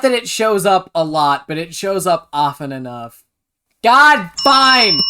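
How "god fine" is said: exasperated. Real, not acted. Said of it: "mock exasperated concession".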